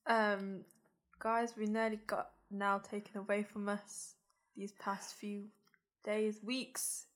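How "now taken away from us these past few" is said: whispered. Real, not acted. Trailing off.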